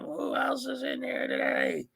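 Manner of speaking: high, cracking roll call. Real, not acted. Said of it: "my voice was cracking".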